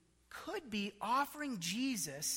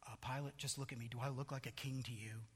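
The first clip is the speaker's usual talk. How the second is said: hushed imagined reply; 7 semitones lower, 12 dB quieter, faster than usual. Pretend. Imagined Jesus answering Pilate.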